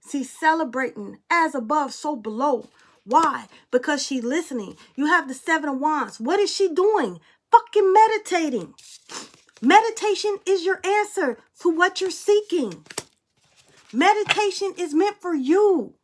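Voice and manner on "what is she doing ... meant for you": fired-up preaching. Acted. Tarot card reading, preaching meditation.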